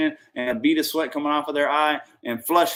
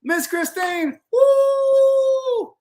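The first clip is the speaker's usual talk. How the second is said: excited; 9 semitones higher, 5 dB louder, slower than usual. Real, not acted. Hyped giveaway announcement.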